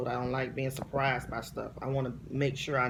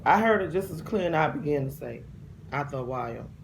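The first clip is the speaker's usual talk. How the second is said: ordinary talk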